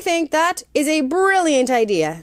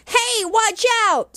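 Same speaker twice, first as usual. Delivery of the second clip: higher, slow shouted warning; Cameron calling out; 8 semitones higher, slower than usual. Acted. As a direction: warn like a startled boy. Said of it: "said Cameron".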